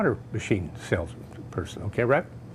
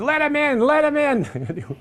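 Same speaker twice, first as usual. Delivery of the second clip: high call, the second slow and drawn out; 11 semitones higher, slower than usual. Retold or imitated. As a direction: shout it from the back room. Wife calling from back room.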